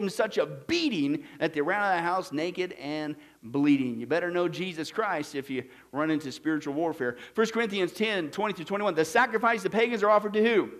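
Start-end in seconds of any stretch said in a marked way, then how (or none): none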